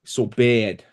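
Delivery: "So bad" in a Boston accent. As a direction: Mark Wahlberg Boston accent. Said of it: "with a Boston accent".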